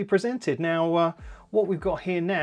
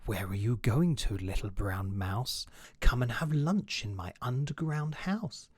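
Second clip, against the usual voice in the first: sly fox; 5 semitones lower, 5 dB quieter than usual. Acted. Fox voice in story.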